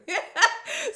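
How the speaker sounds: loud, high laugh; high burst of laughter. Real, not acted. Bursts out laughing after joke.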